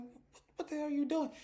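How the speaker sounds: very high, stammering horrified question; horrified shock. Retold or imitated. Varney walking in on Charles with bodies, horrified shriek. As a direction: stumble over it in high-pitched panic. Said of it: "Varney's like".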